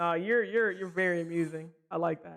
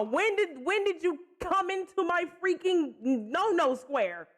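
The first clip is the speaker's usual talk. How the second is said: much higher and louder, slowing in disbelief; flustered protest at a line too close; shocked outburst; 8 semitones higher, 4 dB louder, slower than usual. Real, not acted. Reacts to the flirty line.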